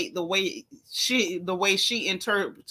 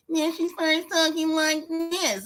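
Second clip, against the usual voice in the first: slow, raised, put-on nasal voice; Karen persona; 5 semitones higher, slower than usual. Shown as a demonstration. Showing friends her switch into Karen persona, mock nasal. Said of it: "The minute she start that Karen persona".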